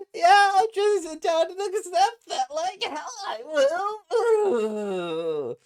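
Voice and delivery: high, loud shout through a mad laugh; laugh-yell your refusal; defiant laughing yell. Acted. He vows to confront Futaba, shouting with a wild laugh. Furious retort to being avoided.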